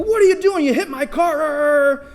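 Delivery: high, loud indignant squeal, the last words slowed; hammed-up outrage at a crash; yelping at the friend who rammed the go-kart; mock outraged driver. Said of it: "you go".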